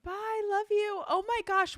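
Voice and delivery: very high, squealing goodbye; bursting with affection; delighted. Real, not acted. Host gushes goodbye to caller.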